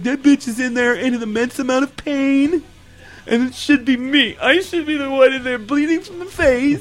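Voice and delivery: lightly voiced jealous girl; mock jealous contestant. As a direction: whine with fake jealousy.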